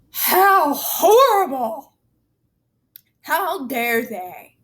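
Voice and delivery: sarcastic mock outrage.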